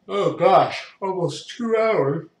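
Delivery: high, slow words through a big yawn. Real, not acted. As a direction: let a yawn pull the words out. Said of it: "a lot of yawns in this one".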